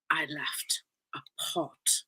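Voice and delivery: slow, hushed confession; conspiratorial whisper. Real, not acted. Telling listeners her secret mistake, slow whisper.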